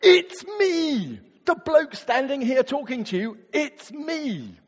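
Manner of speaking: bursting with the good news; earnest excited. Retold or imitated. Jesus revealing himself to the Samaritan woman, eager loud. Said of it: "what Jesus said was".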